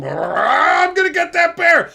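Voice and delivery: the shamed hunter swears revenge; hammed-up determined hunter; vowing hunter. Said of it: "he goes home".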